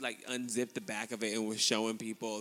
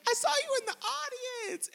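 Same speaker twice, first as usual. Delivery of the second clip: much higher, squealing with delight; excited Tamar; 7 dB louder, faster than usual. Retold or imitated. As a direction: gush like a fan spotting a friend. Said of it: "gets excited when she sees me".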